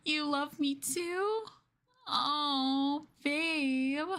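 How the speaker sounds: high, slow, near-tearful joy; teary delight; overjoyed. Acted. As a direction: gush like it's the best news ever. Overjoyed at partner's words.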